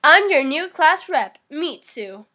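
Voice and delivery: lightly acted tsundere introduction. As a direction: announce it like a mild tsundere. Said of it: "tsundere voice meme".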